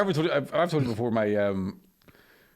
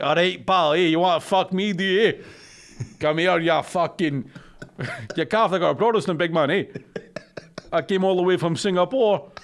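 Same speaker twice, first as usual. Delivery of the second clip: put-on Glaswegian accent. Imitates woman with Glaswegian accent.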